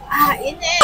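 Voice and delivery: sudden high yelp at hot food; startled cry, burned by hot bite. Real, not acted. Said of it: "Ang init, napaso ako". (biglang napasigaw sa init)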